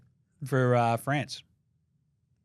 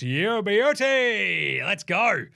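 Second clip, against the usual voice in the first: drawn-out delight; 6 semitones higher, 4 dB louder, much slower than usual. Real, not acted. Thrilled at Sixers-Celtics fixture.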